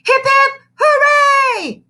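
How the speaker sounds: very high loud cheer; a classroom cheering. Acted. Kindergartners cheer for the boy, loud. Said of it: "chimed the kindergartners".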